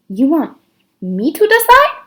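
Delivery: high, puzzled rising question; a bewildered young girl; confused girl. A narrator's reading. Myra asks her father in high puzzled surprise. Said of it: "Myra looked confused".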